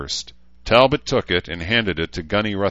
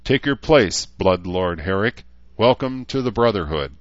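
ceremonial welcome; 2 semitones higher, 4 dB louder than usual. Acted. Talbot admitting the new Blood Lord, formal projected welcome. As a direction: welcome him formally before the ranks.

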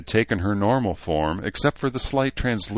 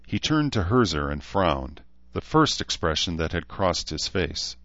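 usual speaking voice

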